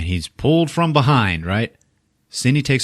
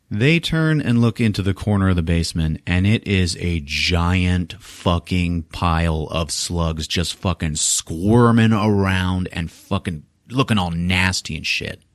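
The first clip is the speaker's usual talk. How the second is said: usual speaking voice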